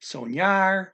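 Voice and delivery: "soñar" with an ny sound in the middle; teacher's model of "soñar", ny glide and stressed ending; Spanish ñ sound. Shown as a demonstration. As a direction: say "soñar" as sone-YAR. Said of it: "it's an N U sound".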